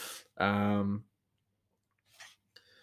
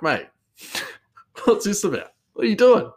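incredulous; 12 semitones higher, 3 dB louder than usual. Retold or imitated. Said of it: "you'd be like".

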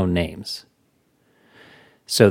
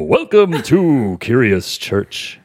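slow, drawn-out jolly greeting; slow Santa-style welcome; 3 semitones higher, much slower than usual. Pretend. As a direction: stretch it out like Santa. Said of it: "Let me try that again".

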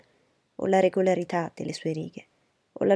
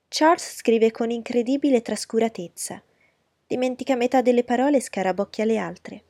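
higher, louder, gushing lady's voice; fawning society lady; 5 semitones higher, 6 dB louder than usual. Acted. Miss Bingley fawning over Darcy's letter, higher and gushing. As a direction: flatter him from a higher, eager pitch.

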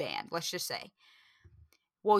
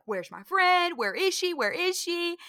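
higher, frantic calling for a lost friend; panicked search; 8 semitones higher than usual. Retold or imitated. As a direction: imitate a scared girl looking for her friend. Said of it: "So she is sobbing".

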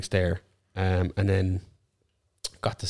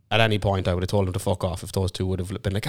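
normal talking voice

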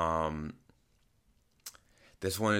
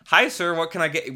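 high bright customer-service greeting, much louder; chipper service voice; 10 semitones higher, 8 dB louder than usual. Retold or imitated. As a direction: sing it out like a trained barista. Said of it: "people in the service industry are so trained".

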